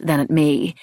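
ordinary delivery, nothing marked

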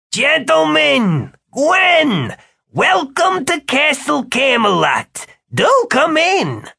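grand welcome, very high and drawn out; high-pitched host calling guests in; jovial Eddie. A narrator's reading. Eddie greets wizards at Castle Camelot, effusive.

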